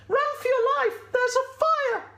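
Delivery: very high, loud squeal of warning; panicked sheep. Acted. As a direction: squeak out a frantic alarm. Sheep warns goat of fire.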